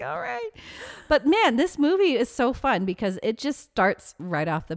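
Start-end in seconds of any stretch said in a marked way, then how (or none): 0.0-0.5 laughing. Real, not acted. Laughing retort.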